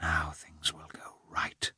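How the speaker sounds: hushed hopeful whisper. A narrator's reading. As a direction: breathe the thought quietly. Hunter's hopeful thought.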